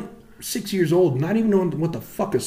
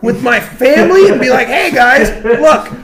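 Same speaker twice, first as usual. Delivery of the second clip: high, loud mock presentation; sarcastic ranting lecture; 7 semitones higher, 8 dB louder than usual. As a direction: announce each page with exasperated sarcasm. Sarcastic mock seminar for family.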